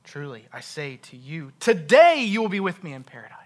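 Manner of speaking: emphatic TODAY, falling away afterwards; stress on TODAY. Shown as a demonstration. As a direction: read evenly, then blast "today". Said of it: "just emphasize a different word".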